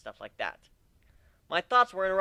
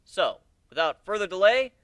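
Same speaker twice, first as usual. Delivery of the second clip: tour guide call; 6 semitones higher, 7 dB louder than usual. Acted. Tour guide addressing group.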